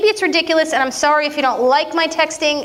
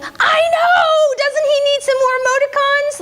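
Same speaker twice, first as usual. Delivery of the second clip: emphatic agreement; 13 semitones higher than usual. Retold or imitated. Wife agreeing with friends about husband's texts, squealing. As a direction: pitch way up, burst out agreeing. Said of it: "I'm like".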